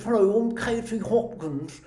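mocking British accent through big lips; Katie Hopkins. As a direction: talk British as if your lips are too big. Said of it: "makes her talk like this".

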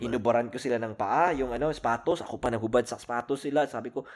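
usual speaking voice

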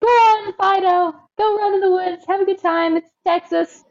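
high, breezy careless dog owner; mock cheery owner waving the dog off. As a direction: toss it off, high and carefree. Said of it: "You're like".

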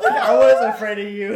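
cracking up at the punchline; loud laugh. Real, not acted. Admitting fear to friends, loud laughing delivery.

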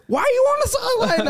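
high, laughing mock confusion; confused brother. Brother on the field, laughing and confused. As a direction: imitate the brother wondering where he is. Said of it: "He's like".

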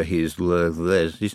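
slow, tongue-tied stumble; words trailing off tangled; stammering. A narrator's reading. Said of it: "tongue couldn't keep up".

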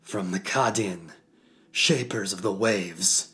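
solemn, rising ritual proclamation; announcing to the skies. Acted. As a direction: call it out to the heavens. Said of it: "announced to the skies".

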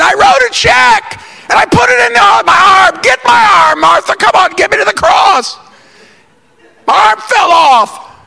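very high, loud hammed-up wailing; panicked mock shout. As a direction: holler it like a comic catastrophe. Acted panic after writing a check.

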